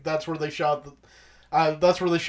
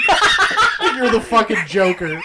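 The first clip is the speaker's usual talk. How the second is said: laughing; 6 semitones higher, 5 dB louder, slower than usual. Real, not acted. Laughing at friend's joke.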